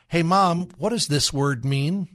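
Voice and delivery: put-on child asking. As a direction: play the curious child asking mom. Child's question voiced by speaker.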